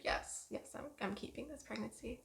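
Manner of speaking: normal talking voice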